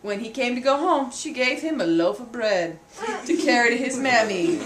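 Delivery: plain reading voice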